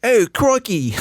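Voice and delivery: a cartoon Aussie caught by surprise; jokey Australian "crikey"; mock Australian accent. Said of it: "that's Australian".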